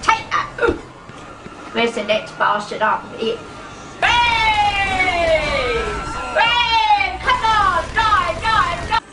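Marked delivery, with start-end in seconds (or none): 4.0-9.0 battle yells. Real, not acted. Gamer yelling at British Gas enemies in the game, high battle shouts.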